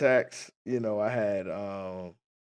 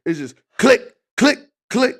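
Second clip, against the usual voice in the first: imitated laser clicks; 8 semitones higher, 5 dB louder than usual.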